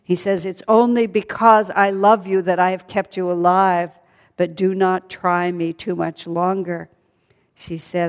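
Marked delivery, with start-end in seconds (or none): 0.4-6.9 loud, threatening warning; angry demon king. Acted. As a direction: lean in and warn her hotly. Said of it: "Ravana became very angry".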